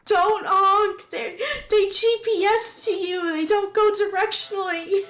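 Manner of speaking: high-pitched, alarmed warning; exasperated game yelling. Real, not acted. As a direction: shout a frantic correction at your teammate. Scolding a teammate mid-game.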